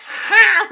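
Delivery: sudden loud high "Ha!" burst; a single loud mock laugh from the theater seat; loud burst of laughter. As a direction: blurt out one big laugh. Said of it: "I busted out laughing".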